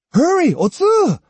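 put-on high, loud boyish hurry-up call; impatient call. A narrator's reading. Jotaro calling Otsu to hurry to the riverbank, impatient call. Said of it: "Jotaro called impatiently".

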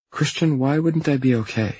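high-pitched female character voice; puzzled girlfriend. Acted. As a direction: lift the pitch right up and question his concern. Girlfriend questions his worried call.

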